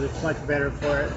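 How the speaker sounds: ordinary delivery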